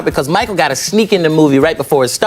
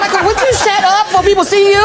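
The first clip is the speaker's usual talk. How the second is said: laughing scold; 12 semitones higher, 4 dB louder than usual. Retold or imitated. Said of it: "I said".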